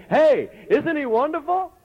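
high, loud excited call; excited praise. Shown as a demonstration. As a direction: call it out to your neighbour with joy. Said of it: "I need to look at you and say".

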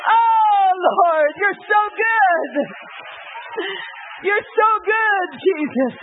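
mother rejoices over honor roll, shouting hallelujah; ecstatic praise. Retold or imitated.

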